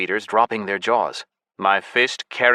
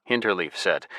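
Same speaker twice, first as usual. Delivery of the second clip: ordinary delivery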